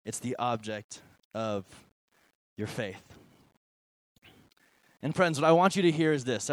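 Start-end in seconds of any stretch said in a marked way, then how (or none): 0.1-3.0 lower, softer on object; soft key line. Shown as a demonstration. Pastor landing the key line for notes, soft and low. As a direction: drop it down quietly. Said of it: "put that up on the screen".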